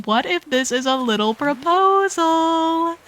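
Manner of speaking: excited gasp. Real, not acted. Excited speculation about proposal.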